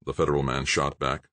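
usual speaking voice

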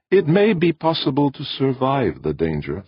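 high, even, unhurried alien voice; flat and calm alien. Acted. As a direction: stay level and untroubled. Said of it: "as flat and calm as ever".

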